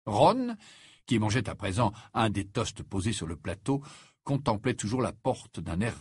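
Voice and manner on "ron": usual speaking voice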